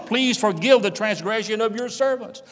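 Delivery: high, urgent preaching plea; impassioned plea. Acted. Brothers begging Joseph for forgiveness, impassioned plea. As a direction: plead fervently, voice pitched well up.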